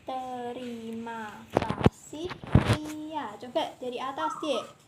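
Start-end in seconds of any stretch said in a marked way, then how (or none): 0.0-3.3 slow, syllable by syllable; over enunciating. Shown as a demonstration. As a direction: read it slowly and clearly. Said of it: "kakak itu ya, membaca kata demi kata".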